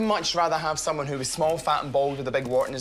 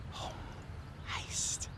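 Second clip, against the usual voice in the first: whisper; 15 dB quieter than usual. Acted. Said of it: "What was all that about?".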